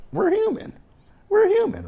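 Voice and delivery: very high squeaky voice on we're human; squeaky falsetto. Acted. Vlogger telling viewers we're all human in a high squeak. Vlogger defending sex workers, playful.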